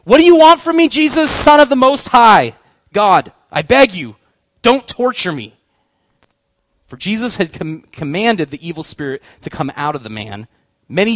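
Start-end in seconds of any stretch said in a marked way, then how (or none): 0.0-5.5 loud, high-pitched cry easing into a plea; shouting. A narrator's reading. Possessed man crying out at Jesus' feet, shouted plea. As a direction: cry out loud, then let it settle as you beg. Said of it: "shouting at the top of his voice".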